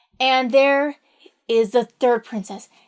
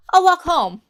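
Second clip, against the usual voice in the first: mock cheerful declaration; 5 semitones higher, 6 dB louder than usual. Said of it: "Halvor's like".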